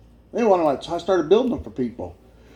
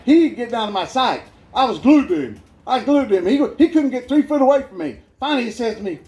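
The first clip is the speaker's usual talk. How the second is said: normal talking voice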